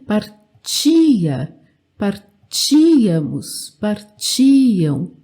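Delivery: "Partia, partíamos, partiam" broken as par-TIII, TI held long; stress on TI. Shown as a demonstration. Teacher showing it works the same way, stress on TI. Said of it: "Também a mesma coisa".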